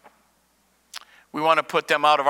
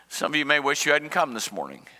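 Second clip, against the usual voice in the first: usual speaking voice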